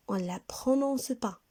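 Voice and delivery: ordinary talk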